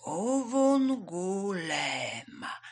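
Uwungulema very slowly, syllable by syllable; tortoise drawing out Uwungulema carefully; slow repetition. A narrator's reading. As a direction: stretch out every vowel of Uwungulema. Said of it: "Żółw powtórzył powoli".

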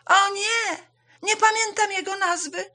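high, loud wail of dismay; distressed hare, voice shooting up. Acted. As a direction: cry out in sudden panic. Hare realises he forgot.

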